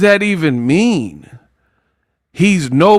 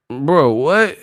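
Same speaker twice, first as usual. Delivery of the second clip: ordinary talk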